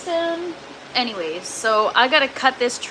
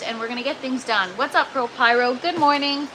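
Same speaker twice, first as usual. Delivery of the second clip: ordinary talk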